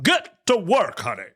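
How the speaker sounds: high, bossy mock Franklin ordering his wife; hammed-up husband order. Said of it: "you play the part of his wife".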